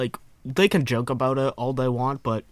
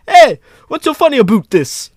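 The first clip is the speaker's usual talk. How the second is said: very loud, high cartoon Canadian accent; South Park Canadian; 13 semitones higher, 15 dB louder than usual. Canadian in court to those laughing at him, shouted Canadian accent. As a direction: yell it like an indignant cartoon Canadian. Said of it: "I love the South Park depiction".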